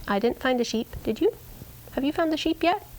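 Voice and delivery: lightly voiced dog, rising high on the last question; a hint of an eager sheepdog checking in; voicing the dog. Dog asking her owner about the sheep, lightly voiced.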